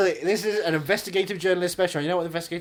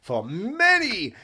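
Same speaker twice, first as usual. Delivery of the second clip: slow ad voice; 6 semitones higher, 5 dB louder, much slower than usual. Pretend. Mock advert drawn out.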